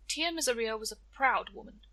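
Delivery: plain reading voice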